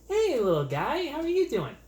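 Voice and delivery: put-on high, bright-eyed baby talk at a little bird; cooing at a bird. Guy greeting a cute bird in a mansion, high cooing voice. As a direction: coo sweetly at the cute parrot.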